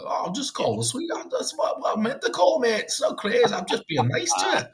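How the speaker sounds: high-pitched mock Yorkshire accent, slipping into Scottish; hammed-up high voice with a Scottish lilt; botched Yorkshire turning Scottish. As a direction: imitate a protesting Yorkshireman, drifting Scottish. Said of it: "that was a bit Scottish at the end".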